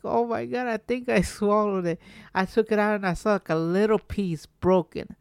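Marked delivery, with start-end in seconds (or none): none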